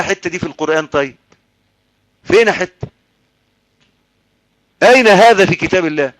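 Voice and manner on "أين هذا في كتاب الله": loud, raised rhetorical demand; forceful challenge. Real, not acted. Preacher demanding proof from God's book, forceful challenge. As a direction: throw the question out hard in formal Arabic.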